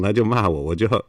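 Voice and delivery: ordinary delivery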